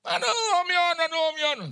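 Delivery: very high, loud cry of the name; imitating overjoyed woman. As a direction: shriek the name with joy. Wife crying out again.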